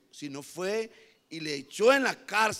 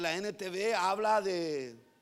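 ordinary delivery

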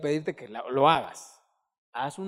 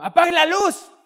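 higher, louder imitated shout; mock yell; 10 semitones higher, 13 dB louder than usual. Pastor showing the shouting he avoids at home, mock yell. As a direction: yell it like his father did. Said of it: "yo no ando gritando".

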